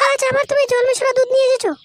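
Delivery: very high-pitched scolding wife voice; nagging wife. Acted. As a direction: squeal the accusation at your husband. Wife scolds husband coming home. (ঝগড়াটে বউয়ের খুব চড়া, সরু গলা)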